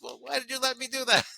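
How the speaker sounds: high-pitched imitation of a complaining wife; mock reproach. Wife to husband about the green couch, mock reproach.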